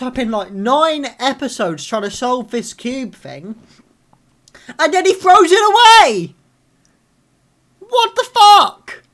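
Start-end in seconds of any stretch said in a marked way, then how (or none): 4.8-9.0 furious shout. Real, not acted. Fan raging that Diggle throws the cube away, furious shout. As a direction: explode in disbelief. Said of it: "I'm so angry".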